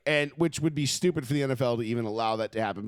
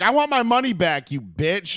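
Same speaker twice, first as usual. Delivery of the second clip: high, louder mock Karen demand; mock entitled demand; 4 semitones higher, 5 dB louder than usual. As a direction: play an outraged rich woman demanding a refund. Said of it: "they'd all be like Sharon Osbourne".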